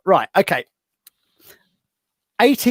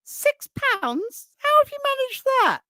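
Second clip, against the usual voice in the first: much higher, astonished and quicker; amazed Tarrant. Retold or imitated. Tarrant reacts to the cheap room, astonished and much higher. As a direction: squeak it in disbelief. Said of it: "He went".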